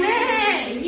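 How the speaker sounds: put-on whinny like a horse for the kids; horse neigh. Shown as a demonstration.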